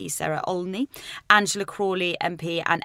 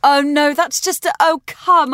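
high, loud protest, drawn out at the end; oh-come-off-it outburst, pitched up and loud; loud disbelief; 7 semitones higher, 8 dB louder, slower than usual. Real, not acted. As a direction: cry out in exasperated disbelief. Scoffing at a tall claim.

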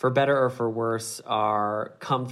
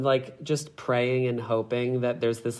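ordinary talk

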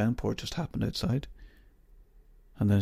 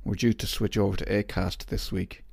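usual speaking voice

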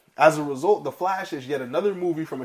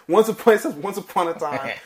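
high laughing read; reading through laughter; 6 semitones higher, 10 dB louder than usual. Real, not acted.

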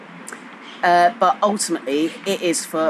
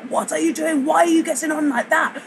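pitched-up mock shout at a passenger; retold shouting; 9 semitones higher than usual. Train guard shouting at a mother with a buggy, mock angry. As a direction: imitate a guard snapping at her, much higher. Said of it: "he started shouting at her".

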